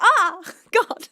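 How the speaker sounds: loud, high mock wail breaking into laughter; retold exasperated cry. Streamer retelling waking up crying from a dream, mock wailing. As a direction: imitate waking up upset, half laughing. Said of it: "And I was like".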